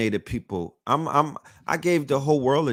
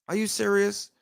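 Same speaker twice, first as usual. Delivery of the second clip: mock disbelief; 8 semitones higher than usual. Imagined listener's disbelief.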